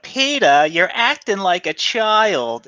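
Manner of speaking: high, slow nagging wife impression; high mock Lois voice. A fan quoting Lois scolding Peter, high. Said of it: "Lois is like".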